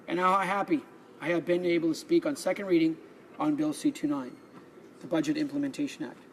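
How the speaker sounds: formal prepared speech in the House; parliament speech. Acted. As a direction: address the chamber, measured and formal. MP delivers prepared budget speech.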